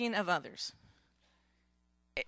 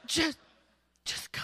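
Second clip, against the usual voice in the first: horse voice. Acted. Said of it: "And the horse is like".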